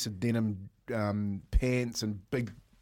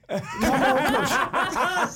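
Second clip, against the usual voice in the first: higher and louder, laughing; 13 semitones higher, 8 dB louder, much slower than usual. Real, not acted. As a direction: laugh while pleading. Laughing about asking for pillow.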